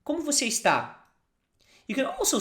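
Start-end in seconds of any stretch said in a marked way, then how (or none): 0.0-0.9 Como você está said clearly, a touch higher; model phrase for repeating. Shown as a demonstration.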